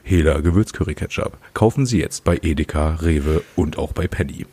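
low, mock commercial announcer; ad read voice. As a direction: read it like an old TV ad.

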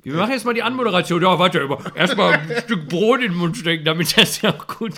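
loud, high, fast teasing while laughing; laughing mock announcement. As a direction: tease him, laughing through it. Mocking co-host, laughing. (lachend spöttisch)

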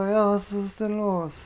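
slow, soft, sleepy half-awake mumble. Retold or imitated. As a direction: imitate a friend just shaken awake. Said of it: "so verschlafen die Augen aufgemacht und meint so". (verschlafen, langsam und leise nuschelnd)

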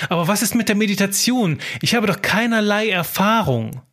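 surprised. Acted. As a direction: blurt it out, caught off guard. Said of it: "brachte überrascht hervor".

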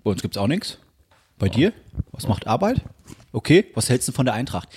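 low-pitched dad small talk; imitated father. Son imitating his father's weekly phone call, low Hessian dad voice. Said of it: "und bei ihm ist es immer".

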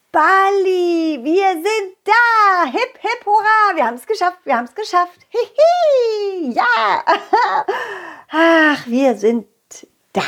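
high joyful cheering; excited. Acted. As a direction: cheer at the arrival. Arrival cheering.

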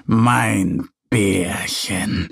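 a hint of an old bear greeting his grandchild; slow low mild grandpa call; lightly voiced grandpa bear. A narrator's reading. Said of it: "ruft er".

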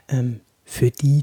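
ordinary talk, nothing marked

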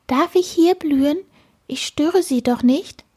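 high, dainty polite asking; delicate flower voice. A narrator's reading. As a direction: ask timidly in a high little voice. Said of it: "sagte sie mit zarter Stimme". (zarte, hohe Blumenstimme)

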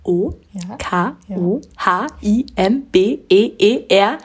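slow letter by letter; spelling it out. Shown as a demonstration. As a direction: spell each letter slowly and clearly. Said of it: "du wolltest den Instagram-Account noch buchstabieren".

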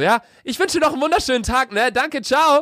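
high, sing-song overly cheerful farewell; mock gushing delivery guy; chipper courier. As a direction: gush a goodbye like he has butterflies. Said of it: "völlig mit Elan".

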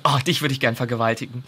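imitated date. Retelling a date's crude remark from the series, mock low aside. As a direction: drop the pitch a little for the quote.

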